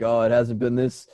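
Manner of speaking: ordinary talk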